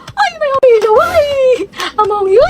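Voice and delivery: very high put-on girlish voice; cutesy squeal. Cutesy girl reacting to classmates, high squeal.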